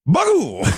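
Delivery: high yelped Bagoo, a sudden hurt squeal; imitated grunt. Friend imitating the hit man's pained yelp. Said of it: "he goes like".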